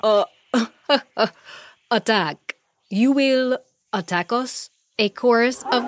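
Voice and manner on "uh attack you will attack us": incredulous, chuckling monkey king; laughing mockery. A narrator's reading. Monkey King mocking the threat of attack, laughing. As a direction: break up laughing while echoing the threat.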